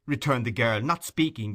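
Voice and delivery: usual speaking voice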